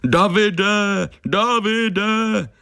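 high, drawn-out mocking call; jeering falsetto-like echo of a cry; mocking imitation. A narrator's reading. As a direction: mimic their calling in a high, sing-song taunt. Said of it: "posměšně napodobil naše volání".

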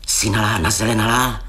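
ordinary talk

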